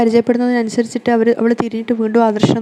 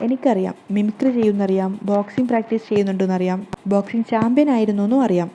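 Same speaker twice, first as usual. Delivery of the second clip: ordinary delivery, nothing marked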